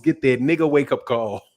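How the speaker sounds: ordinary talk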